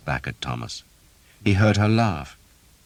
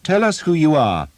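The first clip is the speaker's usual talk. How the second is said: put-on higher, louder, commanding young lord; demanding; 12 semitones higher, 7 dB louder than usual. A narrator's reading. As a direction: demand it with easy authority. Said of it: "the unseen man demanded".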